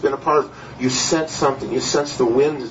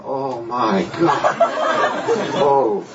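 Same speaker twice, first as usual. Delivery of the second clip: low, drawn-out mock dismay; retold dread; 4 semitones lower, much slower than usual. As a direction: stretch it slowly, sinking in alarm. Retold dread as people fall.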